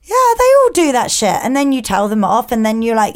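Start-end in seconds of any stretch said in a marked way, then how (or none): 0.0-1.4 higher, louder exclamation; emphatic burst. Real, not acted. Mother telling co-host kids all smash rooms, emphatic. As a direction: throw it out with force.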